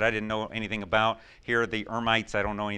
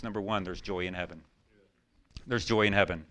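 ordinary talk

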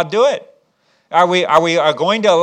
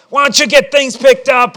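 higher, louder frustrated parent act; yelling; 8 semitones higher, 6 dB louder than usual. Pretend. Frustrated parent to the kids, yelling. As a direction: holler at the kids in mock exasperation. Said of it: "You're yelling".

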